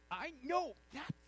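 high put-on voice; tattling accuser. Playground-style accuser pointing out Peter, high tattling voice.

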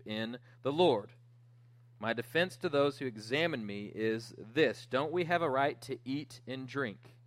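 scripture reading. Acted. Preacher reads Paul's defense to the church, emphatic scripture reading. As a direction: read it out forcefully, driving each rhetorical question.

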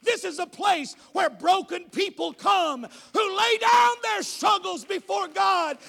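building to a loud shouted climax, slowing at the peak; preaching at full force. Acted. Pastor rallying the congregation, shouting with building fervor. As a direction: preach it big, rising to a roar.

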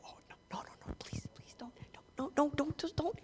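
whispered frantic begging; pleading whisper. Acted. Haman begging as he is exposed, in a frantic whisper. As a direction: beg in a terrified hush. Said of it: "He's like".

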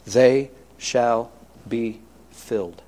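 "They shall be filled" drawn out slowly, each word weighted; slow solemn promise. Shown as a demonstration. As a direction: let the promise sink in, word by word. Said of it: "that's what Jesus said".

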